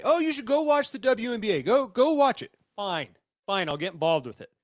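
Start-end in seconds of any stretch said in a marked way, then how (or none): 0.0-2.5 high-pitched mimicry of pushy friends; mimicked nagging. Host mimics his group chat pushing the WNBA, mock nagging. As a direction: imitate them pestering, pitched well up.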